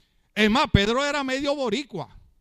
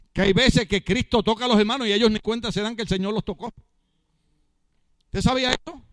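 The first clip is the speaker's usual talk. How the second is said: usual speaking voice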